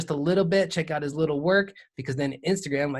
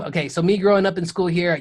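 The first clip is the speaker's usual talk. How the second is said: usual speaking voice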